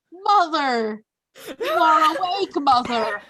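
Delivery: put-on high, loud pleading child voice; a little bear cub crying for its mother; childlike bear voice. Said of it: "Carl comes up to you and he's like".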